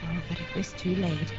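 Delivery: ordinary delivery, nothing marked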